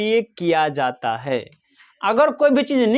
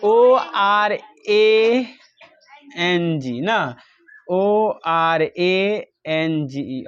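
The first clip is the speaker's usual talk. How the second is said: spelling out slowly; 4 semitones lower, much slower than usual. Shown as a demonstration. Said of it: "orange का wording सही है".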